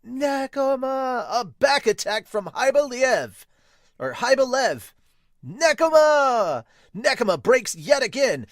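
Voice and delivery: booming play-by-play call; announcer shout. Acted. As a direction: hype the crowd on each name.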